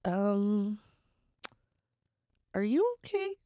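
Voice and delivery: high, startled check on him; a hint of alarm, voice jumping high; startled concern. Asking the crying man if he's okay, startled and high.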